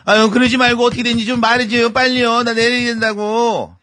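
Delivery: high-pitched wheedling auntie; hammed-up pleading, dragged out at the end; whiny pestering. A narrator's reading. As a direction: nag and plead to be told right away. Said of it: "결말이 궁금한 아줌마는 때를 썼어요".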